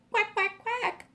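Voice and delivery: little duckling quacks; ducklings quacking. Acted. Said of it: "says the baby ducklings".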